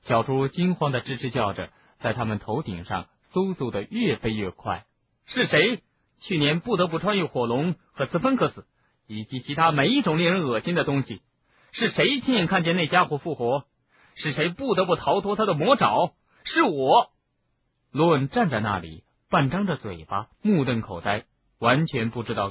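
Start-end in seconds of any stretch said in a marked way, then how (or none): 5.3-17.1 raised, high-pitched angry questioning; high, heated string of challenges; indignant outburst. A narrator's reading.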